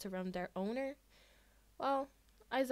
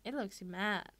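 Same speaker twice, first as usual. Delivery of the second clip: plain reading voice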